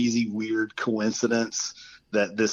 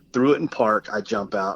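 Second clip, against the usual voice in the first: usual speaking voice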